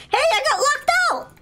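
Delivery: squeaky high voice calling through the door; high kid voice. Acted.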